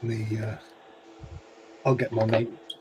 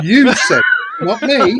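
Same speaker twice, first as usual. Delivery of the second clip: giggling through a teasing denial, higher and louder; laughing; 11 semitones higher, 5 dB louder than usual. Real, not acted. Friend laughs, insisting he said it, not her. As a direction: laugh the words out, pinning the blame back on him.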